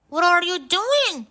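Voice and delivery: high, loud shouted question; alarmed cry. A narrator's reading. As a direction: cry out to stop him. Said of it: "cried Scott".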